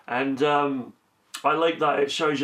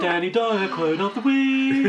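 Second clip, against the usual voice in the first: slow, higher jingle-style announcement; announcer voice; 8 semitones higher, 4 dB louder, slower than usual. Acted. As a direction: boom it like a segment title. Announcing a segment title.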